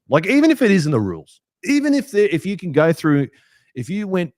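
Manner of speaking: fired-up rant against the bunker call; heated rant. Real, not acted. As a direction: push it out as an angry rant. Ranting about the try call.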